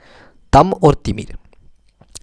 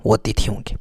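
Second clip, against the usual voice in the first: whisper; 2 semitones lower, 6 dB quieter than usual. Real, not acted. Teacher drops to a whisper.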